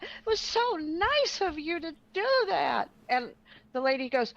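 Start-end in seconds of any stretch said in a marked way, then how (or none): 0.0-2.9 high, slow fake gushing. Retelling Penny's praise to the stranger, high and gushing. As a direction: drip fake sweetness.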